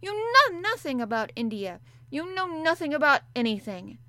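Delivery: put-on enraged child yelling; raging. A narrator's reading. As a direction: lash out in humiliation. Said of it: "did not even try to control her rage".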